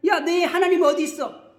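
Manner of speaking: high, loud mocking challenge; acted accusing taunt. Accuser challenging the believer about God, loud mocking taunt. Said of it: "그리고 참소하는 거예요".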